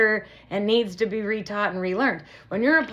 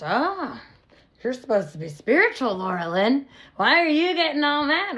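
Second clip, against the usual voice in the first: mocking quote; 6 semitones higher than usual. Mocking what negative people say.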